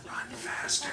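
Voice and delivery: breathy whispered taunt; barely voiced, hissing it out. Husband whispering to fleeing wife, soft whisper. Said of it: "I'll just whisper".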